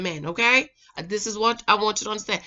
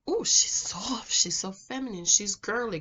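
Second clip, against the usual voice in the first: soft and feminine; 4 dB quieter than usual. Acted. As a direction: coo it softly, barely voiced. Acting out a soft feminine woman.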